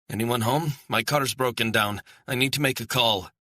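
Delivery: high, loud call for help; urgent calling out. A narrator's reading. Stranded woman knocks at a stranger's door, urgent calling out. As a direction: call out urgently to whoever is inside. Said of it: "Her shouting was getting louder, more urgent".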